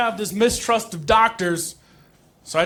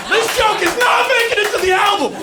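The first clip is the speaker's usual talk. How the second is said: laughing shout; 13 semitones higher, 4 dB louder than usual. Real, not acted. Comic laughing at own flub.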